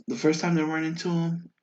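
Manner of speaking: ordinary talk, nothing marked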